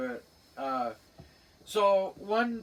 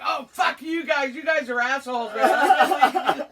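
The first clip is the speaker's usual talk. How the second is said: loud, high cursing through laughter; mock insult breaking into laughs; Larry laughing; 8 semitones higher, 9 dB louder, slower than usual. Storyteller voicing the pranked brother, laughing curses. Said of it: "Larry bursts out laughing".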